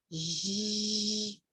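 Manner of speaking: drawn-out soft "zhhh" buzz; long "zh" sound. Shown as a demonstration. Teacher demonstrating how G can sound, sustained "zh". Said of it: "ela pode ser assim".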